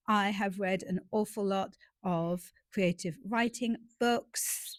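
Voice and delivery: usual speaking voice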